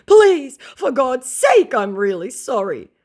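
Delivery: very high, loud desperate whine; begging scammer. Retold or imitated. Scammer begging her in a very high whine. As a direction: beg shrilly for mercy.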